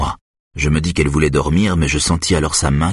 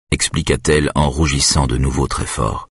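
plain reading voice

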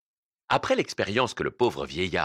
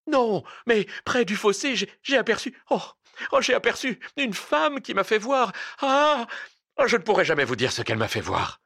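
high, loud, breathless stutter of fear; a fleeing man gasping out what he saw; stammering terror; 12 semitones higher, 3 dB louder than usual. A narrator's reading. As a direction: stumble over the words, panicked. Terrified man stammers his tale.

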